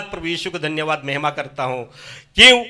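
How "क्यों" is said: fervent testimony. Real, not acted. गवाही में प्रभु की महिमा.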